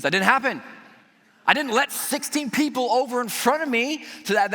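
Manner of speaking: ordinary talk, nothing marked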